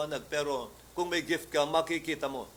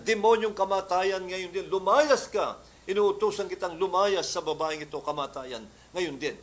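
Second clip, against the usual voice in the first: commanding rebuke; 3 semitones higher, 4 dB louder than usual. Retold or imitated. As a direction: order death out of her. Rebuking death in the story.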